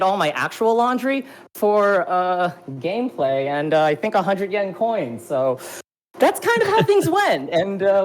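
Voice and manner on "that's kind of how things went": high, giggling delivery; laughing. Real, not acted. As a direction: laugh through the words. Laughing while telling story.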